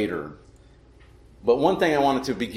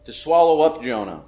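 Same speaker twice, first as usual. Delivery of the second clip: solemn scripture reading; 3 semitones higher than usual. Real, not acted. Pastor reads Jonah's prayer to the congregation, solemn and declaimed. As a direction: proclaim the passage line by line, pausing between phrases.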